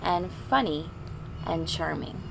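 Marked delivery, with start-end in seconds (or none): none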